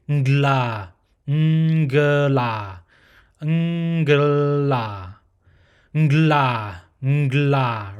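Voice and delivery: ngla repeated slowly and loud, nasal ng into g and l; ngla demo. Shown as a demonstration. Teacher drilling the ngla sound, loud and slow. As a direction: mash the three sounds into one ngla. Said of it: "a nasal plus a g and a l all together".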